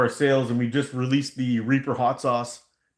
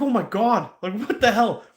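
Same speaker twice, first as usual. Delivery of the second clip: shocked; 8 semitones higher than usual. Retold or imitated. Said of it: "I was like".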